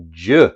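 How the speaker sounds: "J" as a short, loud "juh"; J sound. Shown as a demonstration. Teacher explains G taking on a J sound, "J" as punched "juh". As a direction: punch "J" as the sound "juh", not the letter name. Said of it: "the G takes on a J sound".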